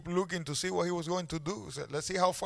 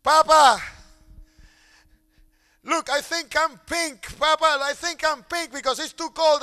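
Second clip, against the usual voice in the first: put-on high, loud pleading child voice; shaking child; 9 semitones higher, 10 dB louder than usual. A father voicing his son, who begs for notice. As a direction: beg like a little boy shivering in the cold.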